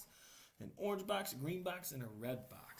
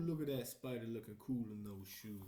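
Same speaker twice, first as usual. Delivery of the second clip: ordinary delivery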